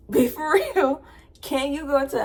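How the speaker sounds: laughing incredulity; incredulous. Real, not acted. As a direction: come on, be real. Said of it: "speaker reacts incredulous".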